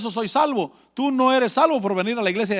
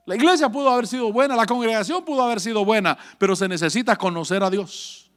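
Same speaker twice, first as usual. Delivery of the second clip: ordinary talk, nothing marked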